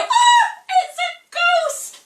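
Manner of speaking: high, loud mock shriek of fright; scared scream. Disciples terrified at the figure on the water, hammed-up shriek. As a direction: scream like frightened fishermen seeing a ghost. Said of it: "So they go".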